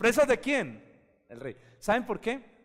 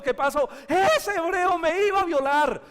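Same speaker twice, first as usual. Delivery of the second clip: high, loud outraged accusation; accusing wife; 12 semitones higher, 8 dB louder than usual. Acted. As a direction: cry it out to the crowd. Wife accusing Joseph to all. (la esposa acusa a José ante todos, gritando indignada)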